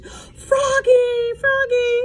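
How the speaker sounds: high loud call. A narrator's reading. Mom calling Froggy away from the cage, loud. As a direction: shout his name to stop him. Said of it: "called Mom".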